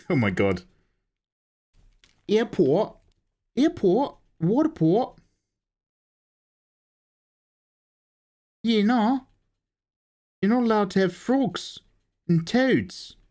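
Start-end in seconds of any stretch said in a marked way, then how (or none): 2.3-3.0 put-on Kiwi accent. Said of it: "doing an impression of a Kiwi". 3.6-4.2 put-on Kiwi accent. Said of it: "doing an impression of a Kiwi". 4.4-9.3 put-on high Kiwi accent. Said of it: "doing an impression of a Kiwi".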